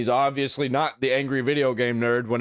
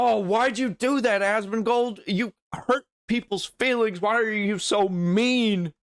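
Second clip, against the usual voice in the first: much higher, whining mock complainer; mock whiny critic; 11 semitones higher, slower than usual. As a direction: whine it like a fake-hurt scold. Mocking critics, whiny voice at Asmongold.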